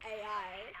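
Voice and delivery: ordinary talk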